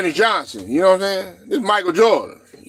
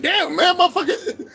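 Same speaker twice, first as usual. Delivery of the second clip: laughing disbelief; 10 semitones higher than usual. Retold or imitated. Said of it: "you like".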